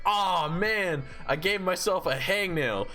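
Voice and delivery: retold groan over a trivial mishap; mock dismay. Retelling injured friend's understated reaction.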